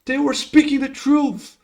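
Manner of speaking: high, loud cry of alarm; alarmed shout. Acted. Young soldier warns his sire of invaders, alarmed shout. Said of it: "he turns around and shouts".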